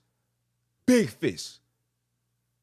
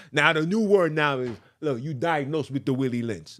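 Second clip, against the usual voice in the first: ordinary delivery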